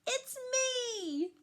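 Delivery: put-on boy popping up calling out; mild shout. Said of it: "Peter shouted and stood up".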